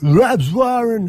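high and very slow drawn-out commentator name; Rabs Warren. Retold or imitated. Host answering with his own footy caller take, slow and high. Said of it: "like, the great Rabs Warren".

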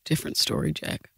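whispered under the breath; whispered aside. Acted. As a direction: murmur it so nobody hears.

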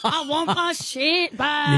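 slow, hammed-up Irish farmer accent calling for his sheep; a fuming Irish farmer accent that slips toward Scottish; mock Irish farmer. Radio host plays an old Irish farmer, demands his sheep back, in a mock Irish accent. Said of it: "You're going Scottish".

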